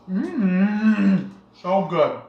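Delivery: talking through a big bite; mouth full. Real, not acted. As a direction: mouth full of food, mumbled. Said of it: "Big bite".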